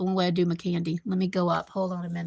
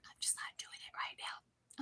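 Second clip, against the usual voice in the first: barely voiced whisper to herself; 23 dB quieter than usual. Whispering her excuse for not calling her husband. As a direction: hush it right down, just breath. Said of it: "I'm like".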